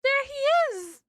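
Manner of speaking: high, slow delighted squeal; excited squeal. Real, not acted. Streamer spotting the mouse character, delighted high squeal. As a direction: squeal with joy at spotting him.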